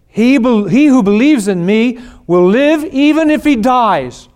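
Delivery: loud, high, ringing pulpit voice; preaching at full force. Acted. Pastor booming Jesus' words to mourners, full-force preaching. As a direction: proclaim it to the whole congregation.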